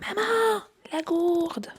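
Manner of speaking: put-on high, light child asking; child's voice. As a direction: imitate a toddler calling mum. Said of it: "Claude me dit".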